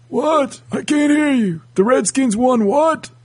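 put-on high, puzzled deaf old man; pretending not to hear. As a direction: shout back like a deaf grandpa. Old men who can't hear.

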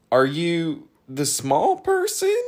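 slow, high question rising at the end; hesitant, high-pitched doubt; uncertain. A narrator's reading. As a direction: draw it out as if unsure. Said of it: "hint of uncertainty in his voice".

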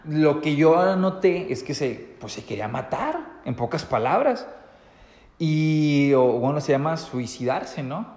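ordinary talk, nothing marked

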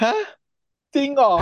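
mock-shocked high squeal. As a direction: shriek it in shock. Shocked reaction voiced in story.

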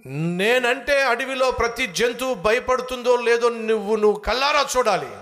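high, loud bragging; boastful fox. Acted. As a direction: declare it grandly to the doubting lion. Fox boasts animals fear him.

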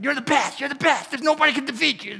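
imitating hype man. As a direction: hype up a boxer before the bell. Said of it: "there's a hype man".

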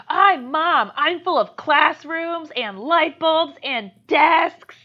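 high, loud, drawn-out declaration; mock outburst. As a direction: imitate an over-the-top tantrum, slowly. Retelling a child's screamed outburst.

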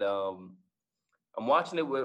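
ordinary delivery

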